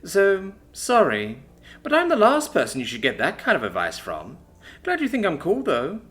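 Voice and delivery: laid-back, self-assured loner; cool mechanic voice. Acted. Brandy answers about mates.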